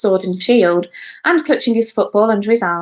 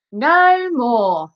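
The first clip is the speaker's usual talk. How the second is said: high, declaimed stage cry; amateur actor's exclamation; exclaiming; 5 semitones higher, 3 dB louder than usual. A narrator's reading. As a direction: proclaim the prompted words grandly. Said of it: "Elvis exclaimed".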